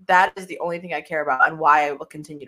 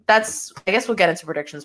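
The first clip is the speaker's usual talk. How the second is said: ordinary talk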